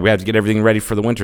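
rattled off very fast; hurried nagging ant, fast; rushed. Retold or imitated. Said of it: "they're like".

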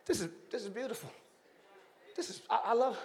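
hushed divine delight; God quietly marvelling; soft, fast admiring God voice. Acted. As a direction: murmur it in awe, quickly. Preacher voices God admiring creation.

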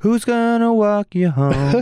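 high, slow mocking voice; sing-song creepy offer; mock chivalrous villain.

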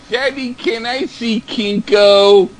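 put-on child voice. Child voice asking father.

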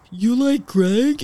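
slow, stunned question; roommate's slow stunned reply; shocked gasp. Retold or imitated. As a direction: gasp out the disbelief slowly.

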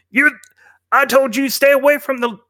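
high, tense bandit warning; a bandit warning someone off; bandit threat. Acted. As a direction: snap a warning at the strangers.

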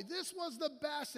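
high-pitched retold yell; excited hallway yell. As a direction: imitate an elated man yelling out. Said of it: "yells in the hallway".

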